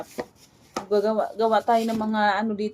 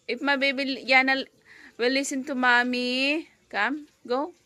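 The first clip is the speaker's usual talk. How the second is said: normal talking voice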